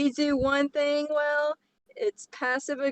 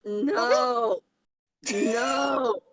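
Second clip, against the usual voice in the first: emphatic no; 3 semitones higher than usual. Real, not acted. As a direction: shout it down, no way. Refusing the bit.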